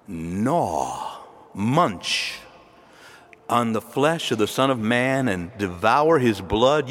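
low, slow lingering on gnaw and munch; graphic emphasis. Acted. The Lord pressing objecting listeners, graphically. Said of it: "he gets very graphic".

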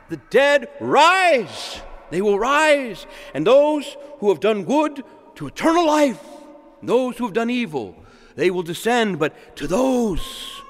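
high-pitched, drawn-out preaching declaration; emphatic proclamation. Retold or imitated. Preacher voicing the Lord's promise of resurrection, emphatic proclamation. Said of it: "He's emphatic".

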